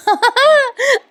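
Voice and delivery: a burst of high-pitched laughter; high squealing laugh. Real, not acted. Laughs at the chores scene.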